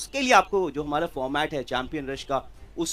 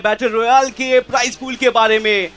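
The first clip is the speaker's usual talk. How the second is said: loud, higher hype intro; big announcement; 6 semitones higher, 7 dB louder than usual. Acted. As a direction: announce it to the whole arena. Caster announcing prize pool.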